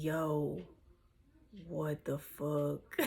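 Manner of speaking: low, soft, slow disbelief; slow hushed bewilderment; retold stunned murmur. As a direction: drag it out quietly, dazed. Retold stunned reaction while high.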